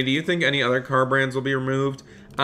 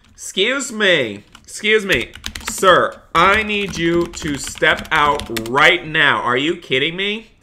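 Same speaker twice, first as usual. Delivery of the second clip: higher, louder police command, slow and firm; stern cop; 4 semitones higher, 3 dB louder, slower than usual. Acted. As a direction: order the driver out like a strict officer. In-game cop ordering a driver.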